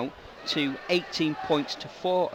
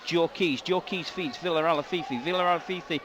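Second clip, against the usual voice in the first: live play-by-play; 4 semitones higher than usual. Acted. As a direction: call the attack near the line with building urgency. Live call of forward drives.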